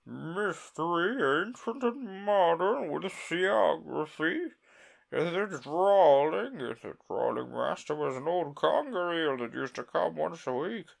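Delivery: high, slow, mournful turtle; Mock Turtle voice. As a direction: recite sadly and slowly.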